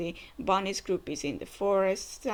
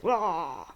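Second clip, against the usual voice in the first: imitated roar; 3 semitones lower, 3 dB louder than usual. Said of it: "And he's like".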